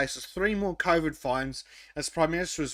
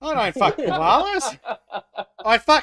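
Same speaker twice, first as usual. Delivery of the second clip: loud, high laughing Aussie protest; mock Aussie bloke; 7 semitones higher, 6 dB louder than usual. Playing the accused Aussie bloke denying it, loud and high.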